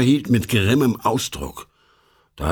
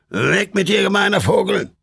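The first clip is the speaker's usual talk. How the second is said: snapping at him; 9 semitones higher than usual. Acted. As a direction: bark him away. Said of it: "fuhr der Obmann gleich den Irrwisch an".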